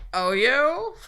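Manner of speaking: high, loud laughing scoff; mocking laugh. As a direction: laugh it off in scorn. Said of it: "laughing so hard".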